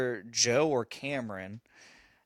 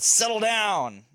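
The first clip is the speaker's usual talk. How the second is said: slow, louder, higher stern call; firm dad command; 9 semitones higher, 5 dB louder, much slower than usual. Retold or imitated. Dad telling his charging son to settle down, slow and firm. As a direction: lay down the law like dad mode kicked on. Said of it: "but I said".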